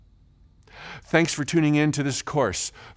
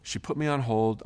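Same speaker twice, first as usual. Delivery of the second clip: ordinary talk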